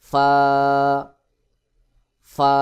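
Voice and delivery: ফা as a true f, not with both lips; lip-to-teeth F. Shown as a demonstration. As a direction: blow air outward on ফা. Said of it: "বাহিরের দিকে বাতাস বের করে উচ্চারণ".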